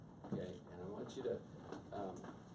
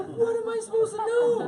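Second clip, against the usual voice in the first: much higher, louder frustrated cry; exasperated protest; 14 dB louder than usual. Retold or imitated. Arguing with his wife, high exasperated cry. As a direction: throw up your hands in disbelief.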